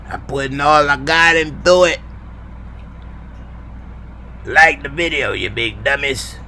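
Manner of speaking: a hint of cranky old sitcom dad; lightly voiced sitcom father. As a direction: lightly play the melodramatic, grumbling father. Sitcom father impression addressed to Lamont.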